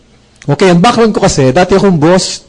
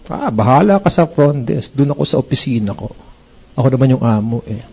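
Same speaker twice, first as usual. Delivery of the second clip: lazy boss; 6 semitones lower, 7 dB quieter than usual. Retold or imitated. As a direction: mutter it off like a boss who can't be bothered. Retelling his lazy boss attitude.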